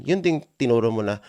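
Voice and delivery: ordinary talk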